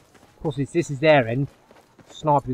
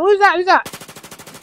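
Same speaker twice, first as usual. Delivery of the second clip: startled; 5 dB louder, much slower than usual. Real, not acted. Sudden enemy appears.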